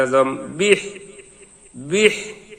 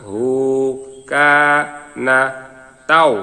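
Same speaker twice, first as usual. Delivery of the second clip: each syllable said alone with long gaps. Shown as a demonstration. Teacher to students, spelling out the verse syllable by syllable. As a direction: break the verse into slow separate syllables. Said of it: "ভাঙা ভাঙা উচ্চারণ".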